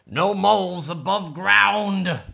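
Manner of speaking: slow, loud, high group shout; rocks shouting. Acted. Rocks forbidding the mole to surface, slow loud shout. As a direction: shout it out slowly as a firm order. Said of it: "shouted the rocks".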